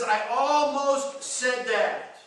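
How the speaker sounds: loud, high, slow admission; preacher's impassioned near-confession; emphatic confession. Acted. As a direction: stretch it out forcefully. Acting out psalmist's near outburst.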